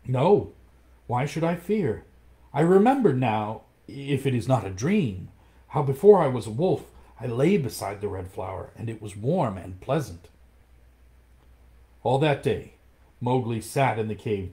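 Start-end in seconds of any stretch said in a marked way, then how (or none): 0.1-10.3 put-on bold boy. Mowgli tells the panther he is not afraid, bold and bright. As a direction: toss off the fear like a brave boy. Boy answers without fear.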